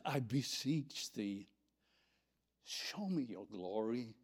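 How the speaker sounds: slow, humble, gentle plea; childlike pleading. Acted. Frail human asking the holy God, childlike plea. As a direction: ask like a trusting little child. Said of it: "like a little child, you know, that inflection".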